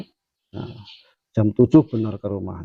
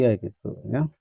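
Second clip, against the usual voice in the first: ordinary talk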